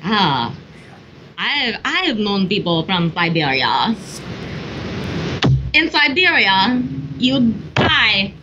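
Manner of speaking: hammy Russian storyteller, climbing in pitch at the end; mock Russian accent. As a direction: tell a grim tale in a fake Russian accent. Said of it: "great accent for you".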